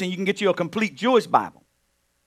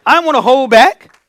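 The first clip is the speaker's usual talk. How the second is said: higher, louder preaching; 9 semitones higher, 12 dB louder than usual. Real, not acted. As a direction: swell into the sermon, higher and louder. Preaching rises.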